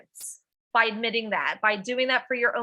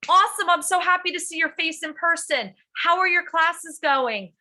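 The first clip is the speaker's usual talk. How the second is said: bright, high, louder burst of welcome; excited greeting; 6 semitones higher, 3 dB louder than usual. Retold or imitated. Teacher welcoming a student back to school, excited and high. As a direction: gush over a returning student.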